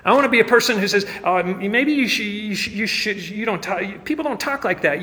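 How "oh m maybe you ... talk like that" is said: high, stammering mock objection; hesitant objector. Someone objecting to how believers talk, hesitant and stammering. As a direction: stumble through it with false starts, pitched up.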